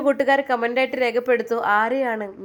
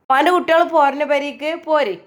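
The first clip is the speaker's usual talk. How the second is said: put-on high, louder call to the children; 7 semitones higher, 8 dB louder than usual. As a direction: call the little ones over, bright and loud. Grandmother calling the children home. (ഉറക്കെ വിളിച്ചു പറയുന്നു)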